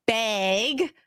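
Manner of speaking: "bag" stretched as "bayg"; emphasized Canadian vowel. Shown as a demonstration. Guest shows her word to the hosts, emphasized Canadian "bag" as "bayg". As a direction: lean on the vowel of "bag", close to "beg". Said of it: "yes, I am emphasizing it now".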